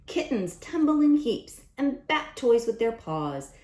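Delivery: lively picture-book read-aloud; sing-song storytime rhyme; reading to children. A narrator's reading. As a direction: read the rhymes brightly to a class.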